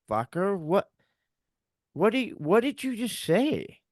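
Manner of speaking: high, stunned disbelief; retold shock. Husband halting the doctor mid-diagnosis, shocked and disbelieving. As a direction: stop him cold, pitch way up. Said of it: "in shock".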